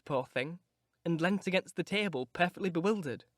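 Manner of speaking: plain reading voice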